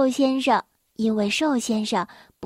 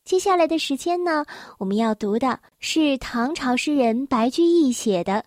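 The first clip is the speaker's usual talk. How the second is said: ordinary delivery, nothing marked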